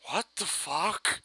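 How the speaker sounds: slow, high, stunned what the fuck; the doctor's dumbfounded reaction; stunned disbelief. Retold or imitated. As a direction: drag it out in mock shock. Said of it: "stands there like".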